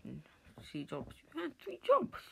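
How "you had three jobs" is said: shocked high pitch. Real, not acted. Disbelief on learning of the three jobs, squeaking high and loud. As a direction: voice jumps way up, much higher and louder. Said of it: "why did my voice go high pitch".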